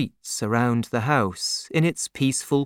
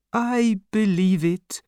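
put-on sad old father; 7 semitones higher than usual. A narrator's reading. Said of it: "said her father sadly".

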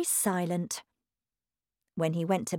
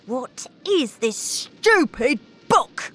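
slow, louder gruff father; angry father; 7 semitones higher, 5 dB louder, slower than usual. A narrator's reading. Father grabbing Matilda's book, angry. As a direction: sneer at the book.

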